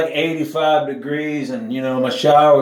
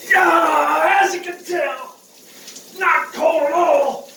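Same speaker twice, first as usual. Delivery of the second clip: high, slow, shivering irony; sarcastic denial; 12 semitones higher, slower than usual. Real, not acted. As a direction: insist it's not cold while freezing. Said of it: "I'm just being dramatic".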